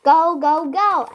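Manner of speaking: loud, high shout. A narrator's reading. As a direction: chase him out loudly. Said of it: "added the middle goblin".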